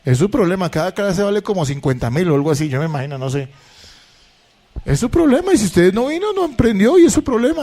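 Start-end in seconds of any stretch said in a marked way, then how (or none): 4.8-7.6 enfático agudo. Acted. Insiste en la universidad.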